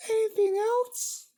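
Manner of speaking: squeaky high princess voice, friendly; high pony princess. Acted. Twilight voiced in the reading.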